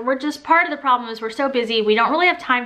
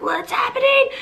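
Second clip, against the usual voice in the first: mock panic; 13 semitones higher than usual. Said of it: "I was like".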